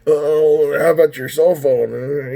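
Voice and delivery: slow, drawling mock agent voice; imitated retention lady. Retention agent changing the subject, mocking drawl. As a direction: put on the unhelpful agent's drawl. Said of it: "The lady was talking just like that".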